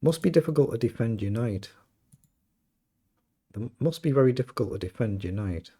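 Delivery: ordinary delivery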